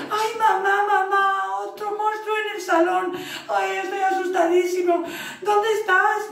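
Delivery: put-on high, loud frightened kid voice; scared child calling. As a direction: cry out for mum in a panic. Child cat panicking.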